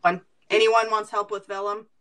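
ordinary delivery

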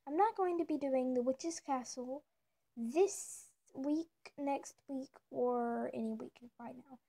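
ordinary talk

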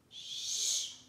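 long voiceless shushing; whispered hush. A narrator's reading. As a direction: shush them so sleepers don't wake. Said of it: "she whispered".

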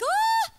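very high loud scream; shock scream. Retold or imitated. Hearing grandmother died, shrieking on the phone. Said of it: "I screamed".

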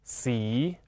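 "si" drawn out, gliding upward; syllable demonstration. Shown as a demonstration. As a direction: model "si" twice with a long rising tone. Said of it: "我们读作".

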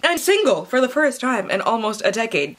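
ordinary talk

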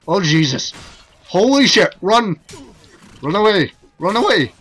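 high, loud panicked shouts to flee; panicked yelling. Real, not acted. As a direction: scream at your troops to run. Mounted knights attack his troops.

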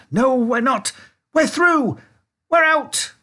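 very high, loud, exultant cries; triumphant shout. A narrator's reading. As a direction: build to a jubilant yell. Wonka triumphant breaking through roof.

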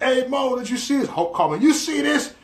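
high, eager imitation of a supporter; excited caller. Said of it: "Trump supporters calling me like".

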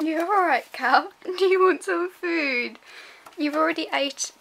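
high, loud cooing at a pet. Real, not acted. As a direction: gush over the dog, slow and sing-song. Cooing at her dog.

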